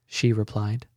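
usual speaking voice

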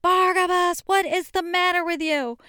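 yelling coach, high and loud; barking gym coach. Retold or imitated. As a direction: scream it like a furious coach. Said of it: "my coach yelled and screamed at me".

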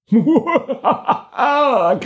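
high, gleeful monster cackle; snowman laugh. Acted. Abominable snowman laughing after catching the crab, high cackle. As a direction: cackle in triumph over the catch. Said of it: "Grinede den afskyelige snemand".

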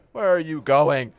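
loud, indignant demand; calling after someone. Acted. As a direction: shout after the cook walking out. Boss calls after departing cook.

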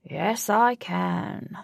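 very slow, purring cat voice. Acted. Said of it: "purred the cat".

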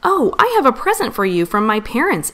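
usual speaking voice

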